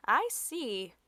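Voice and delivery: ordinary delivery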